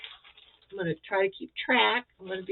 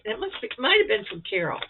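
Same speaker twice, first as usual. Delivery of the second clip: usual speaking voice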